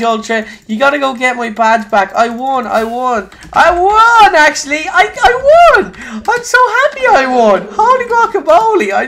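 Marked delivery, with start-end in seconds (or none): 3.5-8.9 very high, loud cries of joy; overjoyed shout. Real, not acted. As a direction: shout with pure delight. Realises he won.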